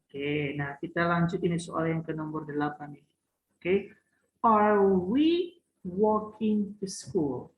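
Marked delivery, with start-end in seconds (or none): 4.4-7.5 slow model reading. Shown as a demonstration. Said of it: "teacher models the question slowly".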